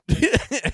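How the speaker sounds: a loud, high burst of real laughter. Real, not acted. Podcaster to listeners, cracking up with a loud laugh. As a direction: laugh out loud at how obvious it is.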